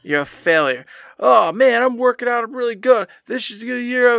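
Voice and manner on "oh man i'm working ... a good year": high-pitched mock boasting; mocking past self. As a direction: parrot a pleased-with-yourself boast.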